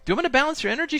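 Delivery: high-pitched gentle offer. Retold or imitated. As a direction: lift the voice way up to offer. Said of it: "And I'm like".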